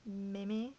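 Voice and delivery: "Mimi" in two syllables, mee-mee; pronunciation option. Shown as a demonstration. Vlogger offering another pronunciation, "Mimi" as mee-mee. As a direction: try out "Mimi" as a questioning two-syllable word. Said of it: "How do you pronounce it?".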